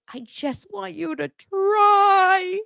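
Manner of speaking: very high, slow sugary mock voice; mocking Robin. Vlogger imitating Robin pleading with Cody, very high slow. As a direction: drag it out, simpering. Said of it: "And she goes".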